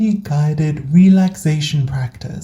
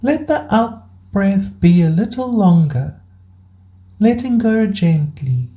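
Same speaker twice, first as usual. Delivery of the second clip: guided meditation. Acted. Host guiding burnt-out listeners into rest, calm meditation voice. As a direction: guide them gently through each breath, unhurried.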